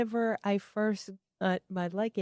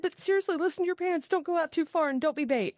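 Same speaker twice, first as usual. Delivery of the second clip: much higher mock lecture to kids; high warning; 10 semitones higher, faster than usual. Warning the little boys in the elevator, high and chirpy. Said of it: "I said".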